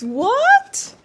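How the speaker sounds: high-pitched incredulous "what"; real squeaky astonishment; stunned disbelief. Real, not acted. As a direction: shoot way up in pitch, amazed. Stunned by an unexpected gift.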